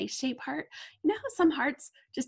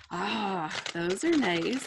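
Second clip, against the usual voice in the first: ordinary talk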